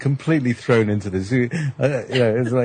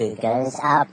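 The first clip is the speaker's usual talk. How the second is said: pitch shifted up; 3 semitones higher, slower than usual. Shown as a demonstration. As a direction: voice sliding up through a shifter. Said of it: "if I turn it to the right".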